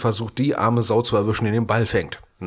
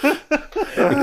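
laughing hard; 10 semitones higher, slower than usual. Real, not acted. Laughing at friend's cramp.

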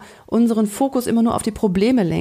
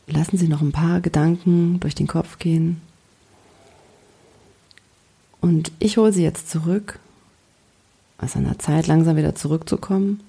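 soothing spa whisper. Acted. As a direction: ease the listener back gently. Slow guided relaxation and countdown. (ruhige Entspannungsstimme)

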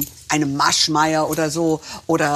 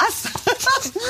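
squealing high laugh. Real, not acted. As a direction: burst into a high giggle. Joke about pulled teeth.

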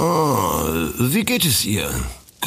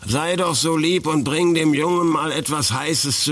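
much higher, calling across the house; 7 semitones higher than usual. Acted. Old man calls out to his wife Ina to bring a hot drink. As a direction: call out to her in the next room.

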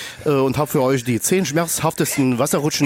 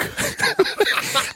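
breathy high giggle. Real, not acted. Host giggles.

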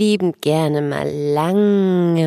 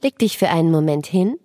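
sleep story narrator. Acted. Guided relaxation for children.

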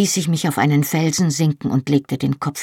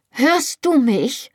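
urgent question; 9 semitones higher, slower than usual. A narrator's reading. Desperate demand to Jamie.

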